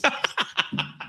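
quiet high breathless laugh; breathy laugh. Real, not acted. Host laughing at himself for insulting viewers, breathy laugh.

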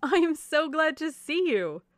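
high, loud, drawn-out greeting; gushing delight. A narrator's reading. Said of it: "expressed great delight in seeing them again".

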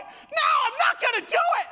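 put-on very high shrieking child; screaming kid refusal. Son refusing to throw up, shrieking child.

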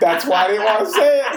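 half-embarrassed giggle while speaking; laughing. Real, not acted. To friends after a risky joke, laughing.

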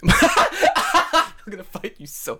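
words squeezed through laughter; laughing. Real, not acted. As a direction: laugh while talking.

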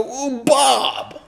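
high-pitched, mock-panicked yelp; panicked blurt. New father at gunpoint blurts a name, mock panic. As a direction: blurt it out under threat.

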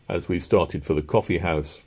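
plain reading voice

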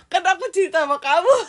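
ketawa ngakak sambil bicara; laughing. Real, not acted. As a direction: bicara cepat sambil tertawa terbahak-bahak. Laughing at friend's question. (tertawa lepas, nadanya jauh lebih tinggi)

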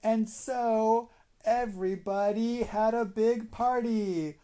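high, slow, joyful narration; excited storytelling. A narrator's reading. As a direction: build up the happy ending. Happy ending of bedtime story.

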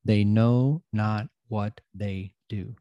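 slowed right down, each word weighed; slow, deliberate quote. Shown as a demonstration. Quoting his motto to listeners, slow and deliberate. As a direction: let the saying sink in slowly. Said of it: "I say that statement all the time".

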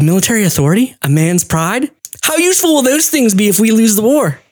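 high, scornful rebuke; mock scolding. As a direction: scoff at their pride. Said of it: "She's like".

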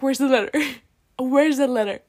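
exaggerated outburst over a lost thing; retold alarm. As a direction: blurt it out in playful panic, laughing between tries. Acts out her alarm at missing letter.

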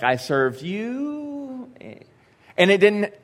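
a mock whine: I served you, where's my reward; whiny self-pity. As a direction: stretch it out like a sulking martyr. Mocking self-pitying complaint to God.

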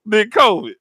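ordinary talk

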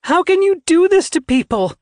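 very high, loud scream of outrage; anguished high shriek. A narrator's reading. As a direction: scream the question at them in horror. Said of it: "she screamed".

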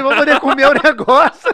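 laughing while complaining; chuckling through the line. Real, not acted.

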